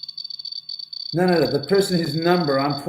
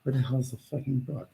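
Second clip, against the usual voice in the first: normal talking voice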